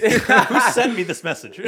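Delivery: slow, laughing mock outrage. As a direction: drag it out through laughter.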